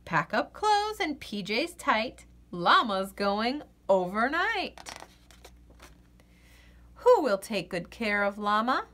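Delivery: usual speaking voice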